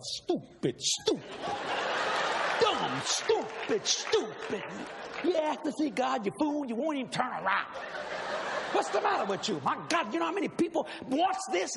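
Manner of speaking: self-scolding. Retold or imitated. Berating himself for not turning.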